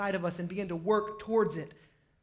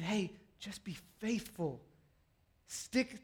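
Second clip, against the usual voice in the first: hushed, slow and spaced out; gentle urging; 8 dB quieter, slower than usual. Acted. God answers the busy person's excuses, gently urging. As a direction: encourage softly, one word at a time.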